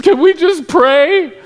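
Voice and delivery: put-on high, loud, drawn-out little-girl wail; mock crying girl. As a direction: imitate a child breaking down in a plea. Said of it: "she breaks down and she's, like, crying".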